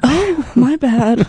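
high, slow, sheepish robot reply; mock bot apology. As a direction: drag out a fake sorry. Porn bot replies sheepishly.

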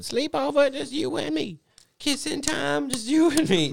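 high squeaky mock sweet talk; sing-song high mock invitation; mock kid voice. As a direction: tease in a silly high voice. Mocking kid's sleepover come-on.

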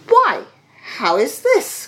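high, loud burst of surprise; astonished cry. A narrator's reading. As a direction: cry out in startled protest. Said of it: "cried the young captain".

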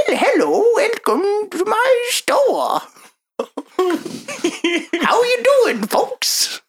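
put-on high, slow little old shopkeeper; tiny old man voice. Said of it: "If it helps with the voice".

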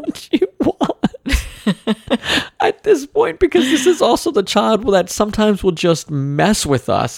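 high, strained, half-laughing complaint; mock-despairing parent; frustrated whine. Real, not acted. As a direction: whine it out, close to tears. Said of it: "I'm feeling very frustrated".

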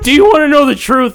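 very high, loud yell; shouted challenge. Acted. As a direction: scream it over the other person. Acted quarrel at its peak.

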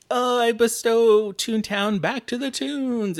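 high, slow, grand cartoon reading. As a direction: proclaim it like a cartoon rabbit. Roger reading will.

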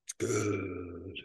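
very low, hushed, drawn-out "good"; spooky Halloween voice. Pretend. Beer verdict redone for Halloween time, mock-spooky. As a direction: creep it out like a mock horror host. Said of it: "because it's Halloween time".

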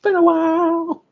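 high squeaky been a while; squeaky falsetto echo. Pretend. Mocking a friend's line in squeaky falsetto.

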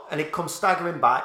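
ordinary talk

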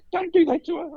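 very high imitated cry of alarm; retold high-pitched plea to stop; alarmed cop. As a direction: squeal it like a horrified onlooker. Cop retold protesting the pin prick.

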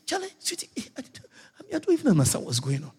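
soft, half-whispered reply to his wife; hushed bedtime talk. Acted. Parents talking in bed at night.

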